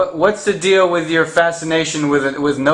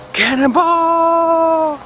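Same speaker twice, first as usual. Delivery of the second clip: long high yell before jumping in; drawn-out cannonball shout; much slower than usual. Real, not acted. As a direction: stretch it out as you leap. Jumping into the pool.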